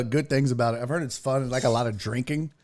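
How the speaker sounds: ordinary delivery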